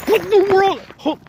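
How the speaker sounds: jolted into a high-pitched scream; startled shriek. Real, not acted. Sudden fright mid ghost hunt.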